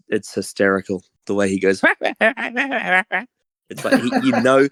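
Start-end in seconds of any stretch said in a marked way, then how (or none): 1.9-3.2 high mock laugh of the writer; imitated laugh. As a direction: imitate his hysterical laugh.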